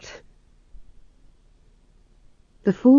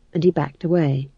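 plain reading voice

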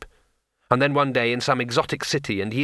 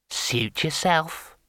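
slow, casual imp voice, higher; 5 semitones higher, much slower than usual. A narrator's reading. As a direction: drawl it like a smug imp.

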